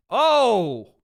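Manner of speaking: sudden loud high exclamation; surprised shout. Real, not acted. Streamer reacting to a big takedown, loud and surprised. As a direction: jolt at the takedown.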